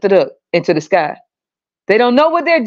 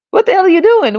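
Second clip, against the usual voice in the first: high disgusted shock; 10 semitones higher, faster than usual. Retold or imitated. Imitating disgusted reaction.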